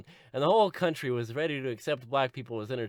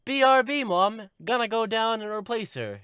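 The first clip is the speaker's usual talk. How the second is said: put-on high, loud excited teen; Tracy; 10 semitones higher, 5 dB louder than usual. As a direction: call out your big plan to Mom. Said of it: "And Tracy's like".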